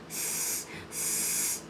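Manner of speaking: soft unvoiced snake-like hiss; angry hiss. A narrator's reading. Angry alligators at the frog, low hiss. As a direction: hiss like a cornered gator. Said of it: "hissed the angry alligators".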